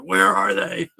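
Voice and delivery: screaming in a low tone; loud gravelly vigilante impression. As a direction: imitate a cheesy, growling superhero. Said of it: "screaming with low tone".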